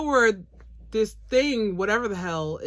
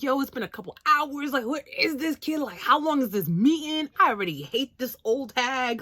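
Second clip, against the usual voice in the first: mock complaint; 6 semitones higher than usual. Voicing Shiki complaining.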